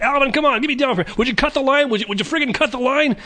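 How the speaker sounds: high, whiny pleading cartoon voice; exasperated Dave. Dave hanging from the ceiling begging Alvin, high and whiny. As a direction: beg in a high frantic whine. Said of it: "He's like".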